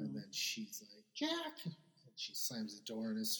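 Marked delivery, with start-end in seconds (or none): 1.2-1.6 high-pitched imitated exclamation; mock indignant. Retelling her slamming the door on Jack, mock indignant. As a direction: squeal the name without raising volume.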